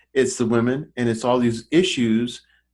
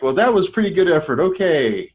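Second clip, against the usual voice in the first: higher, louder, fast and condescending; dismissive pat on head; 5 semitones higher, 4 dB louder than usual. Retold or imitated. Mocking Hollywood for going back to old ways: quick, patronizing praise. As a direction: brush it off like a bored studio boss. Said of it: "It's like".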